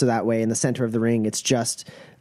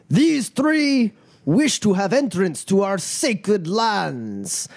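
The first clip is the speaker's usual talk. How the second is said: raised, measured proclamation; ceremonial fight master; 8 semitones higher, slower than usual. Acted. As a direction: declaim it grandly to the crowd. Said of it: "steps back and goes".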